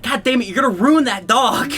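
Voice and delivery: imitated scolding outburst; grandma scolding. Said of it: "my grandma's like".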